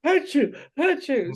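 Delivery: playful fake sneeze sounds; imitating sneezes. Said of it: "so we're all sneezing".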